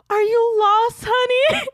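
high, sugary mock concern; older woman. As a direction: imitate an older lady asking a lost child.

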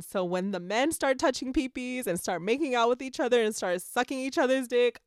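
ordinary talk, nothing marked

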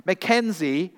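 name called out high and loud; urgent call. A narrator's reading. Sophia calling Mackenzie sharply, loud urgent call. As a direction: startle him with his name, loud.

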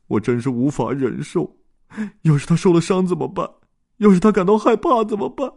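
a voice about to break; holding back tears. Acted. Dodds after hours of searching, tearful and rising. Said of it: "多兹强忍着眼中的泪水".

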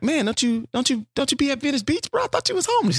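high, scoffing disbelief at a beggar; high mock-incredulous street voice; mock incredulous friend. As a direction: scoff at him in a high put-on voice. Mocking a rich beggar friend.

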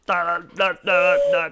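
loud, high da da da; nonsense noises. Shown as a demonstration. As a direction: chant nonsense syllables loudly. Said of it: "make random noises".

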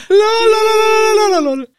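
very high, loud mock laughing chant; albernes Auslachen. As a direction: squeal it like exaggerated mocking laughter. Hohes, lautes Auslachen mit Lol. (albern, sehr hoch und laut)

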